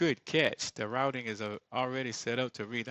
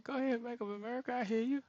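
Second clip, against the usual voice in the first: mock applause; 13 semitones higher, faster than usual. Real, not acted. Mock-praising a bank email.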